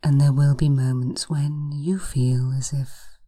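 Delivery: slow, hushed hypnosis voice; breathy guided relaxation, unhurried. Acted. Hypnosis session guiding relaxation.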